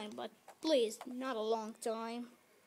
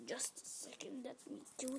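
whispered aside; quick whispered aside; 13 dB quieter than usual. Real, not acted. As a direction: breathe it under your breath. Muttering aside while fiddling with game.

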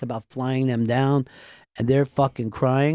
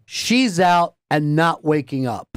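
ordinary talk

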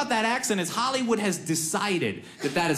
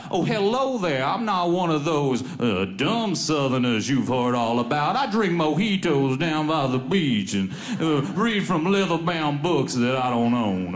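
genteel, hammed-up plantation Southern drawl; plantation owner Southern accent; 3 semitones lower than usual. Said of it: "Plantation owner Southern".

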